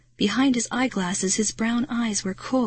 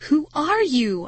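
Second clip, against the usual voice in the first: mild shriek, higher pitched; 7 semitones higher than usual. A narrator's reading. Girl confronting a stranger posing as dad, shrieking. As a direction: demand it in alarm. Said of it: "I shrieked".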